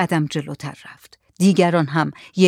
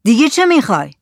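raised, louder demand; shouted question; 5 semitones higher, 5 dB louder, faster than usual. Acted. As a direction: shout it at the stranger, pressing. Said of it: "زکریا داد زد". (با داد پرسیدن)

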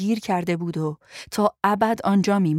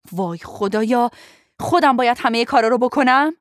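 louder, higher exasperated outburst; fed-up raised-voice complaint; 4 semitones higher, 6 dB louder than usual. Acted. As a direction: snap in loud exasperation. Exasperated after a weak slap.

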